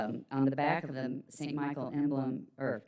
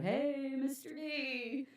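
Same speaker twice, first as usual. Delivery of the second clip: mock yelled greeting; 10 semitones higher, 4 dB quieter, much slower than usual. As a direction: imitate friends hailing each other. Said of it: "they'd yell out".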